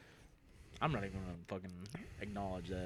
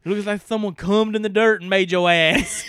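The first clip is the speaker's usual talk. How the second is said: higher, louder roasting promo; wrestling promo; 9 semitones higher, 8 dB louder than usual. Acted. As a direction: shout the roast at your rival. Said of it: "I'd cut a promo on him first".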